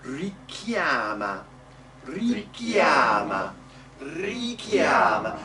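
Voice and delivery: drawn-out "Richiama" with one short K; single K. Shown as a demonstration. Coach modelling the word for singers, single K in "Richiama". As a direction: stretch "Richiama", never holding the K. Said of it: "Be careful not to double the k".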